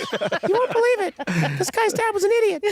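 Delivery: a bird telling the other birds about the idiot dad, high and squeaky; gossiping bird. Pretend. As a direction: chirp it like a bird spreading gossip. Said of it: "he's off to tell the other birds".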